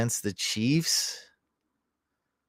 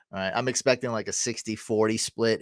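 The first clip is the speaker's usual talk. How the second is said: ordinary talk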